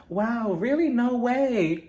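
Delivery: hammed-up insincere surprise; fake interest. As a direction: imitate phony concern. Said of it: "this fake ass conversation between them".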